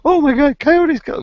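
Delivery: very high, loud mock alarm; city newcomers shocked their cat was taken, shrieking; mock shocked city folk. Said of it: "they were like".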